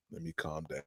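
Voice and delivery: ordinary delivery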